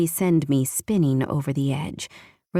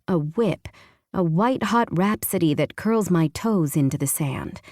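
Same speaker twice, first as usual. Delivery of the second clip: usual speaking voice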